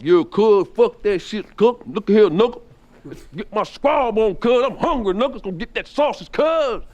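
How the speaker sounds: high, loud street tough demanding food; gangbanger voice. Retold or imitated. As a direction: bark it like a hungry gangbanger. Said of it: "he starts going through the whole gangbanger".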